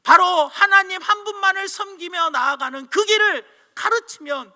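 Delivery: loud, high, impassioned preaching; fiery preaching. Acted. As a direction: drive the message home to the congregation. Preaching at full force. (온 힘을 다해 외치는 설교)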